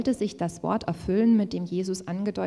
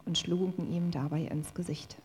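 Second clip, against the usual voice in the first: usual speaking voice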